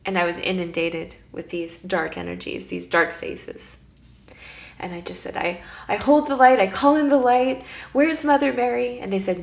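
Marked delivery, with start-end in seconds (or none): 5.4-9.0 defiant call. Retold or imitated. Defiant call against demons.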